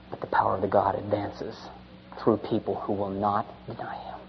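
soft, low grave sermon; hushed solemn preaching. Acted. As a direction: let it sink in quietly.

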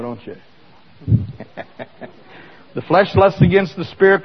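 ordinary talk

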